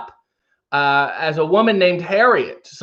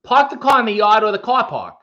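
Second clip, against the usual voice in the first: higher-pitched Boston accent with broad ah; r-less Boston twang, pitched up; 5 semitones higher than usual. Shown as a demonstration. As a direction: lean on the Boston ah. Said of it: "More ah in the Boston accent".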